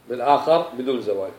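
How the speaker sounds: ordinary talk, nothing marked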